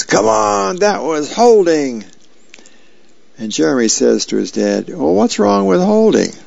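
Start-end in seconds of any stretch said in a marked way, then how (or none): 0.0-2.0 high, far-off shouting dad voice; dad yelling at the TV. A narrator's reading. Dad yells at football on TV, raised shout. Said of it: "recliner and yells". 4.9-6.3 put-on higher, cheeky teenage voice; teen son. A narrator's reading. Jeremy teases his dad about holding, cheeky raised voice.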